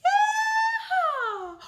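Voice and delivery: high, loud whooping yeehaw with a laugh; big joyful cowboy whoop. A narrator's reading. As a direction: holler it like a cowboy calling the cattle in. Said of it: "he let out his cowboy call".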